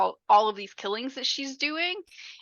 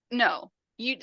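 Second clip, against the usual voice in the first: normal talking voice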